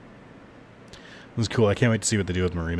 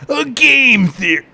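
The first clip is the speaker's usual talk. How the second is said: much higher and louder, slow big announcer yell; announcer catchphrase; 9 dB louder, slower than usual. Acted. Streamer mocking a theory, big announcer yell. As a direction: boom it like a YouTube intro.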